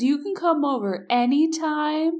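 high, sing-song comforting voice; tipsy friend pats his head and comforts him; soothing drunk friend. Retold or imitated. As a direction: coo at him like a sick child.

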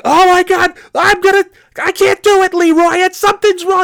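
very high, strained panicked cry; acted panic. Novice driver begging trucker for help, acted panic. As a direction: act out panic at the wheel, voice way up.

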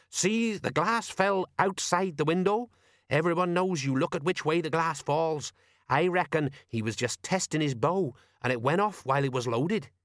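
know-all sergeant, deducing; Colon. Acted. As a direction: explain like a self-taught detective.

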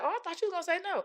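very high, quick surprised voice; shocked grandmother. Retold or imitated. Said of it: "she was shocked".

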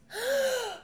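sharp, high fake death gasp; imitated sudden gasp. Mom faking her final breath, a sudden high gasp. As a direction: gasp as if taking a last breath. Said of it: "all of a sudden my mom goes".

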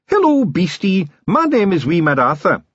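high Scottish wee man greeting; cheerful tiny pixie; Scots Feegle voice. A narrator's reading. Wee Mad Arthur to seagull.

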